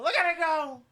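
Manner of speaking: high, loud excited shout. Real, not acted. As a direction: cheer as it flies. Toy flies, shout.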